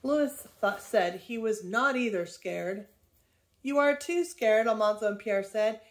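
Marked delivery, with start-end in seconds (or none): 3.6-4.7 louder, higher jeer; taunting. A narrator's reading. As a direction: jeer at a frightened playmate. Boys taunting Louis in quarrel.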